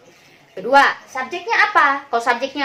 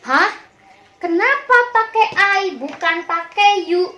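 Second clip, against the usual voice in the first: higher-pitched, baffled pupil's question; puzzled objection; 5 semitones higher than usual. Acted. Pupil questioning the teacher's rule, puzzled. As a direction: ask it as a confused pupil, pitched up.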